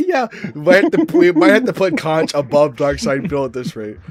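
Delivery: high, loud words broken by laughter; laughing too hard to finish the sentence. Real, not acted. Laughing at George Washington joke.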